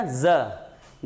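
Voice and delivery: ज said as a buzzing 'z'; nukta sound. Shown as a demonstration. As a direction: voice ज as a friction 'z', not 'j'. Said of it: "नुक्ता लगा दो तो क्या बन जाएगा". (ज़ को घर्षण वाली ध्वनि से बोलें)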